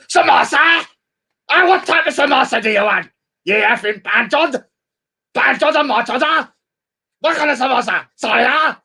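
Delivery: high, mocking voice of a shop assistant; high mock voice of the woman as a shop server; mock shop lady. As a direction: lampoon her serving samosas, pitch pushed up. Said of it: "serving you samosa".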